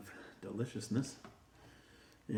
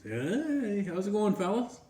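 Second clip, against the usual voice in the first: much higher, breezy greeting; cocky Great Dane; 9 semitones higher than usual. Acted. Great Dane greets the other dogs, in a much higher breezy voice. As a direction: stroll in like you own the place.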